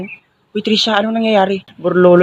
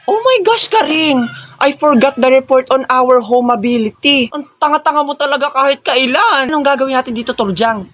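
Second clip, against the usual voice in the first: panicked shriek; 7 semitones higher than usual. Acted. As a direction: panic over the forgotten report. Forgot the report, panicking. (taranta at matinis na sigaw)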